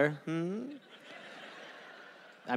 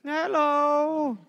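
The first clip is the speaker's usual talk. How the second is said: calling hello; 6 semitones higher than usual. Acted. Survivor calling to rescuers.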